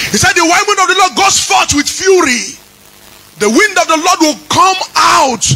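shouted, sung preaching over the music; full-force preaching. Acted. Pastor calls down the God of vengeance in a fiery sung sermon. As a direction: preach it with fire and fury.

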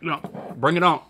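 talking while chewing food; words muffled by chewing; mouth full, chewing. Real, not acted. Seen 1: Close-up: lips move like chewing between words after eating earlier.

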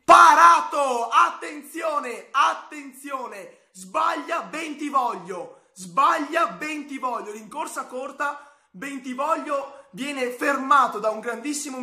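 drawn-out, urgent play-by-play call; excited commentary. Real, not acted. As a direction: stretch each word as the save happens. Live penalty shootout commentary.

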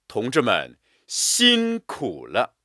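辛苦 punched, slow and full; slow, stressed reading. Shown as a demonstration. Teacher reading aloud, weight on 辛苦. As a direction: lean hard on 辛苦. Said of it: "辛苦” 两个字也是加强我们的力度和音强".

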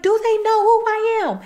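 very high, fast fake-frightened squeal; acting scared. [name] in the car faking panic to get let out, very high squeaky fear. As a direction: play up the panic to fool him. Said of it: "get on her acting skills".

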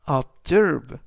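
"observe" as ob-ZERVE, second syllable stressed. Shown as a demonstration. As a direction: punch the -SERVE of "observe". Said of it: "S 뒤에 있는 E의 강세가".

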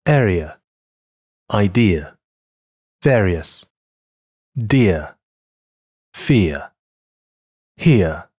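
"Area, idea, various" with a smooth e-to-a glide; each word said slowly and clearly as a model; model pronunciation. Shown as a demonstration. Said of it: "下面我们来练习下ear在几个单词中的发音".

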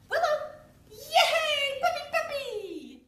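high, loud, laughing cheer; delighted cheer. Real, not acted. Trainer cheering her puppy with delight.

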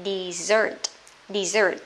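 Dessert stressed on its final syllable, de-SSERT; stress on second syllable. Shown as a demonstration. Teacher repeats the word for dessert dish, second syllable stressed. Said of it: "Âm hai nhá".

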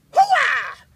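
high, loud whooping yell; Hooah battle cry. Real, not acted. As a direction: shout it like a war cry.

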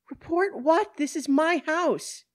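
high, loud, indignant outburst; outraged protest. Acted. Woman told police must report her, outraged protest. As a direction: object loudly, outraged.

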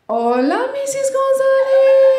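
put-on high, drawn-out Spanish-accented welcome; effusive greeter. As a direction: sing out the surname, rising and held very long. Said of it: "I know who's greeting me at the door".

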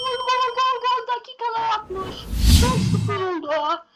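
high, ringing goal cry; commentator goal call. Pretend.

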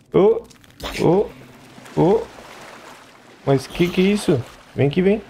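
high excited call to the creature. Real, not acted. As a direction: lure it over, voice jumping up. Calling a creature over.

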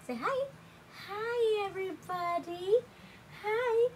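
high cooing voice. Real, not acted. Urging the baby to greet viewers in high baby talk.

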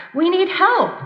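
men shouting back at the voice; shouted back. Retold or imitated. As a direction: yell back pleading for help. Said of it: "they shouted back".

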